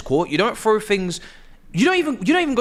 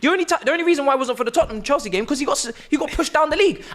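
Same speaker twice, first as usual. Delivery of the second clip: angry rant; 8 semitones higher than usual. Real, not acted. Ranting about the referee.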